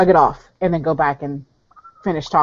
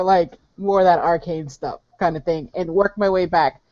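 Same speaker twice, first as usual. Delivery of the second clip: normal talking voice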